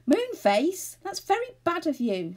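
high, loud, worried scolding; anxious reproach. A narrator's reading. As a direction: scold him with alarm in the voice. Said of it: "said Joe anxiously".

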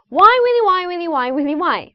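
repeated "Why, Willy" stepping down from very high; rhythmic tongue twister. Shown as a demonstration. Coach demonstrates the feel for students, descending rhythmic chant. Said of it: "이 리듬".

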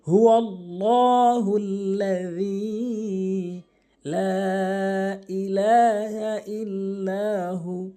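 slow, sustained chanting with long-held vowels; Quran recitation. Shown as a demonstration. Teacher opens the Quran lesson with a verse, slow melodic recitation. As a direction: recite it slowly and melodically, stretching each elongation.

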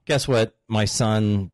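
ordinary talk

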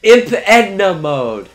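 slow, high and loud proclamation; loud excited announcement. Real, not acted. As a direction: announce it like a big reveal. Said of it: "It's Edna from The Incredibles".

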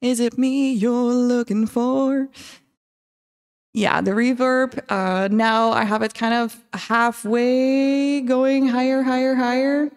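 big reverb echo effect. Real, not acted. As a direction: voice in a reverberant space. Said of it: "let's open up the reverb".

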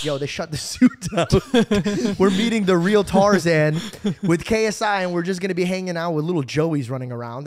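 loud, laughing hype; yelling it out with a laugh; excited friend. Retold or imitated. Friend hyping the zoo trip.

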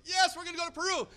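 high, loud eager group yell; crowd shout. Retold or imitated. Leaders answering the preacher, loud eager shout. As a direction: shout back with fired-up agreement. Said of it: "He goes".

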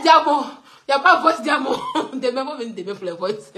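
real laughter running through the words; amused, barely getting the sentences out; laughing hard. Real, not acted. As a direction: keep teasing while cracking up. Laughs through repeated accusation.

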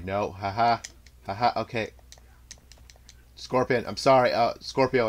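normal talking voice

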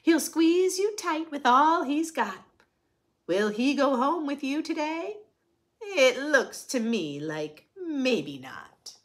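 lively, sing-song picture book reading; reading to children. A narrator's reading. Reading a rhyming picture book to children, sing-song. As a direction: read it brightly to the little ones.

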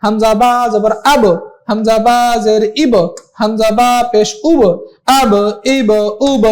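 measured drill with a kick after each final b; qalqalah bounce. Shown as a demonstration. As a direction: pop the b at the end of আব, ইব, উব into a short echo. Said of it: "একটু ধাক্কা দিয়ে পড়া".

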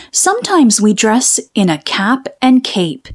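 ordinary delivery, nothing marked

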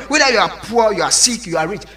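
normal talking voice

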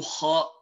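kha from the top of the throat; tajweed letter kha. Shown as a demonstration. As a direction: breathe kha out from the upper throat. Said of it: "two letters which originate from this point".

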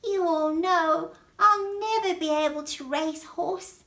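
high, loud snail voice; worried snail. Acted. Snail tells gathered friends of the challenge, high and loud. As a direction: announce it urgently, pitched right up.

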